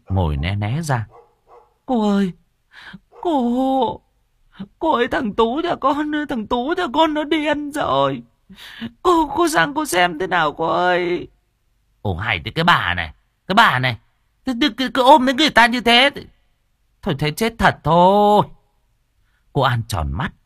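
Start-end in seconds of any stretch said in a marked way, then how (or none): 1.9-11.3 high, crying woman begging; distraught mother sobbing at the medium's feet; wailing plea. A narrator's reading. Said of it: "ôm lấy chân cô mà khóc lóc". 12.0-18.5 irritated. A narrator's reading. Cô An annoyed at bà Nụ.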